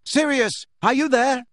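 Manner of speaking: high, loud calling; desperate, call into the empty kitchen; Harry shouting into empty kitchen; panicked shout. A narrator's reading. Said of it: "he shouted".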